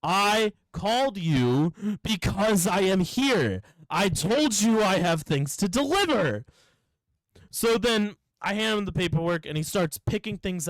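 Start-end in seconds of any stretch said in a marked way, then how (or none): 0.0-6.4 slow, emphatic mock exasperation; exasperated retort. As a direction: spell it out to someone not listening, slowly. Acted exasperated reply to customer.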